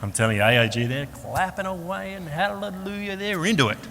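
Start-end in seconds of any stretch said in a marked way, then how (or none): 1.1-3.8 stretched-out, high-pitched mock worship; mock happy clapper. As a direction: send up an ecstatic churchgoer in a high voice. Mocking ecstatic happy clappers at church.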